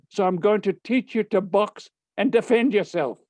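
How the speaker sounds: high, emphatic teacher voice; rally the pupil like a boxing coach; acted fired-up resolve; rousing declaration. Teacher rallies boy to fight back.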